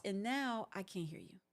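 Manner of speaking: ordinary talk, nothing marked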